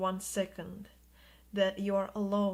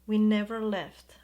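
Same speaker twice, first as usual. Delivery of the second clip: louder, pressing insistence; emphatic; 7 dB louder than usual. Acted. Spirit guides insisting to the viewer they never left. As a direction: promise it with force.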